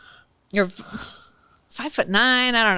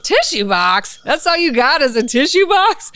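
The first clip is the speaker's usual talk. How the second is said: scoffing disbelief; 6 semitones higher than usual. Retold or imitated. Opposing lawyer scoffs.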